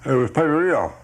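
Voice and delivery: talking through a mouthful of Danish; words muffled by food, mouth full. Acted. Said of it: "He speaks with his mouth full".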